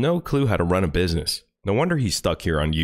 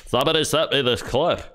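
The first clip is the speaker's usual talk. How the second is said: higher, put-on voice aping the host; mocking impression; 6 semitones higher than usual. Mocking the podcast host's words back at him. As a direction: parrot him back sneeringly.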